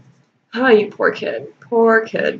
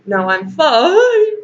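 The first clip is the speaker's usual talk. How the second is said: higher, slow cracking fine; mock voice crack; 6 semitones higher, much slower than usual. Bella insisting she's fine, voice cracking mockingly. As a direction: ham up a voice breaking on fine. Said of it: "Her voice cracked on fine".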